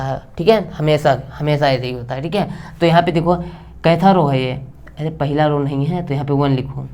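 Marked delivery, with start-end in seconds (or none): none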